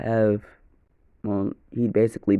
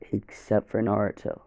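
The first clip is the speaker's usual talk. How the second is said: ordinary delivery, nothing marked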